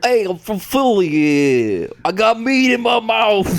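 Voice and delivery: loud mock Philly accent. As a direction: bellow it in a hammed-up Philly accent. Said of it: "I can't do the Philly accent".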